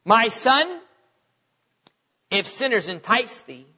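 measured pulpit reading of Proverbs; scripture reading. Acted. Preacher reading Proverbs aloud to the congregation, solemn and measured. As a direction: read the verses with weight and cadence. Reads scripture, voices sinners.

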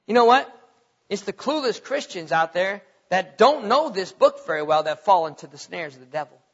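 ordinary talk

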